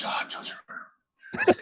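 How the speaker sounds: high, very slow whispered drawl of his name; a hushed, drawn-out Pierce greeting; imitating Pierce. Imitating Pierce greeting a young actor in a slow whisper. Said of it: "He goes".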